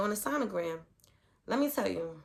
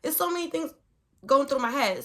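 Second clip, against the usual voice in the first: usual speaking voice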